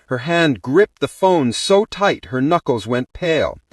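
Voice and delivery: ordinary delivery, nothing marked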